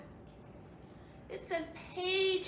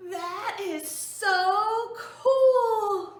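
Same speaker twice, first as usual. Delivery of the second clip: loud, slow, thrilled squeal; overjoyed elephant shouting; excited; 6 semitones higher, 12 dB louder, much slower than usual. Acted. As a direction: burst out with delight, much louder. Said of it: "He's so excited".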